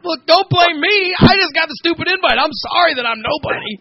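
high, pleading mock protest; exasperated mock plea. Pleading with angry paparazzi, exasperated. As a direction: beg them not to blame you, in mock exasperation.

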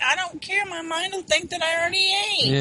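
high-pitched mock defiance; defiant retort. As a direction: act out a stubborn, high-pitched protest. Acted quote of defiant reply.

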